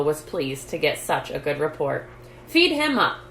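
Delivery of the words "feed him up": bright, high and loud send-off; cheerfully. A narrator's reading. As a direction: call it out with good cheer. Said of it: "said the doctor cheerfully".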